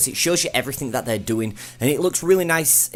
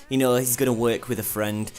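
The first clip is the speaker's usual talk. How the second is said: usual speaking voice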